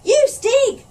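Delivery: a rowdy pirate yelling an insult; shout. A narrator's reading. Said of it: "shouted one of the pirates".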